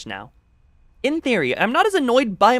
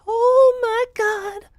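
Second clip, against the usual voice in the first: put-on much higher, slow drawn-out valley girl gasp; 3 dB louder, much slower than usual. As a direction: stretch it out high like a valley girl. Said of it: "I can hear that voice".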